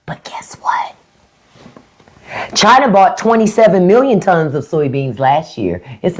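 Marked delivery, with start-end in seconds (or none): 0.1-1.0 hushed teasing whisper; stage whisper to the viewers. Real, not acted. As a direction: lean in and whisper the reveal. Conspiratorial aside to viewers.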